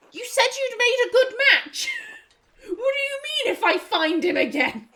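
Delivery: incredulous. Acted. Teasing the letter writer.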